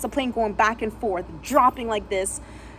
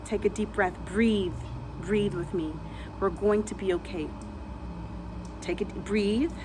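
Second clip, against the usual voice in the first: softer, lower calming voice; soothing calm; 4 semitones lower, 8 dB quieter than usual. Retold or imitated. She calms the terrified man beside her, soft and soothing. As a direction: breathe with him gently. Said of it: "and I said".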